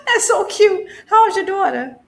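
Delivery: high, loud, laughing through the words; real laughing delight. Real, not acted. Co-host tickled by host's daughter interrupting, laughing delight.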